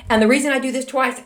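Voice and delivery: ordinary delivery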